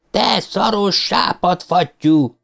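the cry forced out by grief; anguished cry. A narrator's reading. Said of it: "préselte ki belőle a kiáltást". (kétségbeesett, kiszakadó kiáltás)

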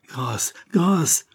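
a hint of a terrified victim; mild pleading cry. A narrator's reading. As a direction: beg for mercy, lightly acted. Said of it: "criait la victime".

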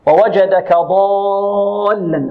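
slow ووجدك ضالا with a very long held "aa" in ضالا; obligatory long madd. Shown as a demonstration. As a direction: stretch the alif of ضالا steadily before the doubled lam. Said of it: "مد لازم". (مد لازم طويل في ضالا)

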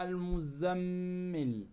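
المزمل with a long hummed m before the last syllable; ghunna on المزمل. Shown as a demonstration. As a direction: hold the doubled m in المزمل. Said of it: "فنظهر ماذا؟". (إظهار الغنة في ميم المزمل المشددة)

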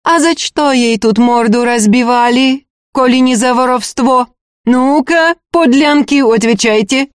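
high, scolding demand; angry interrogation. Acted. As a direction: berate them and demand an answer. Steward berates and interrogates the women.

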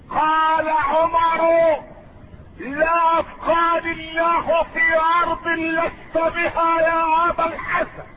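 high, sustained sermon cry; ringing pulpit declaration; preaching at full force. Real, not acted. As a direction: proclaim it with stretched vowels.

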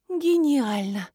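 understated moaned exclamation; mild moan. A narrator's reading. Marina reacting to the opened litter box, mild moan. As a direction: let it out as a light groan. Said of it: "простонала Марина".

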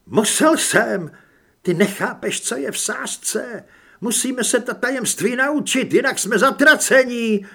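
high, whimpering self-justification; whining plea. A narrator's reading. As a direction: whine desperately to be understood. (fňukavé, zoufalé ospravedlňování)

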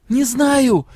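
put-on weeping girl snaps at the secretary; tearful outburst. A narrator's reading. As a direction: blurt it through tears. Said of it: "По щекам опять потекли слёзы".

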